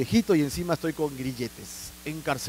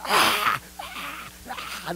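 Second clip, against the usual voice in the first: mock birthing screams; 5 semitones higher, 4 dB quieter, much slower than usual. [name] giving birth screaming.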